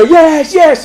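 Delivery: high-pitched eager cries; acted audience chant; crowd shouting yes. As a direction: call out like excited children. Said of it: "they all go".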